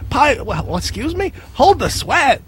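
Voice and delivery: high, teasing crank-call voice; prank caller voice. Retold or imitated. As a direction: needle him with a mock-innocent prank call. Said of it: "He used to get so mad".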